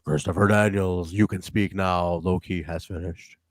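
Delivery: low-pitched, measured wrestler impression; low-key promo. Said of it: "just being very low key in this one".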